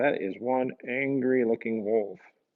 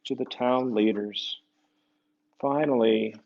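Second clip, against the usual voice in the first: story narration; 2 semitones higher, 5 dB louder than usual. Acted. Reading aloud to children, lively storyteller. Reads picture book aloud.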